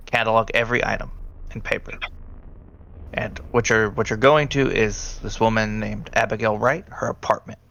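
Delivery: normal talking voice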